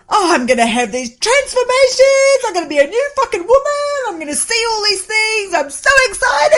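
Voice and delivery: high, loud, gushing self-mockery; overexcited, hammed-up; mock excitement. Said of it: "I was like".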